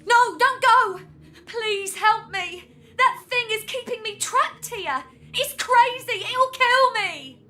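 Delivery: high-pitched, panicked begging; frightened plea. Acted. Captive begging strangers for rescue, terrified. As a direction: plead desperately, drawing out the last cry.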